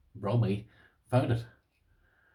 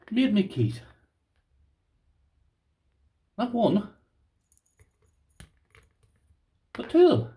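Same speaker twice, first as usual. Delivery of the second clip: excited; 12 semitones higher, 5 dB louder than usual. Real, not acted. Thrilled about Brummy's handmade keys.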